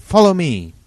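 high loud shout; shouted command. Acted. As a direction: yell it as you start to run. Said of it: "I cried".

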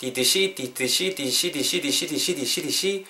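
"did she" repeated until the sounds blend; "did she" running together as one word; linked "did she". Shown as a demonstration. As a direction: drill "did she" into a run-together "dishi". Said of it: "이렇게 음이 연결되거든요".

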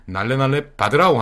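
put-on barking in a North Korean accent; bossy North Korean canteen guard. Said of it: "북한 말투입니다".

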